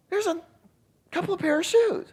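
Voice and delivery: high falsetto confession, imitating the wife; sheepish wife. Wife admits shoes to husband, sheepish falsetto.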